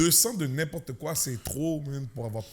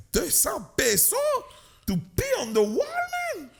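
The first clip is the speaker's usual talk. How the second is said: outraged disbelief; 3 dB louder than usual. Retold or imitated. Retold indignant reply.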